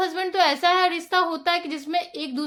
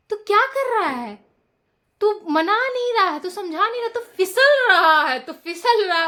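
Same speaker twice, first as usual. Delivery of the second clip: higher-pitched teasing voice; singsong mock jibe, higher; mocking taunt; 5 semitones higher, 4 dB louder than usual. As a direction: imitate a friend taunting you're slipping. Samarth mocking Abhishek, making fun.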